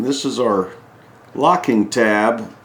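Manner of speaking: ordinary talk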